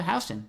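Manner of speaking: Houston said as HOW-stun; Houston opening like "how"; local street pronunciation. Shown as a demonstration. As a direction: say Houston with "house" at the front. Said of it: "we call it".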